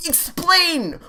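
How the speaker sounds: very high, stretched explain; desperate plea. Real, not acted. Player to the game, high and desperate. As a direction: beg for an answer.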